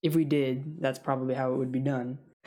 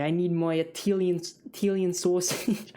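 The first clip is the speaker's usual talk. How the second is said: higher, drawling fake Boston accent; mock Boston accent; 4 semitones higher than usual. Fussy Boston customer demanding Italian sausage, mock Boston accent. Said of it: "I don't know how Boston people talk".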